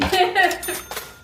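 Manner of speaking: bright cackle after a joke; real laugh. Real, not acted. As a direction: laugh out loud at your own joke.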